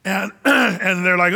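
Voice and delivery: usual speaking voice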